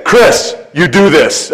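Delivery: name first, then the direct order; firm command. Retold or imitated. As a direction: give it as an imperative. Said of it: "No, no, he's like".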